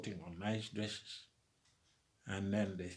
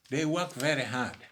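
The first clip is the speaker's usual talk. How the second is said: louder and higher, almost shouting; rousing shout; 5 semitones higher, 10 dB louder than usual. Real, not acted. Orator praises the ancestors' toil to the crowd, near shouting. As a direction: call it out to rouse the crowd.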